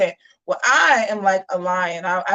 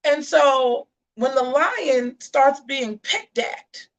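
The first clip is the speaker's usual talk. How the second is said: ordinary talk, nothing marked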